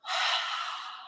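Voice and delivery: long breathy exhale through the mouth; soft whooshing sigh; audible out-breath. Shown as a demonstration. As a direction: let the breath rush out voicelessly. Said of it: "out through the mouth".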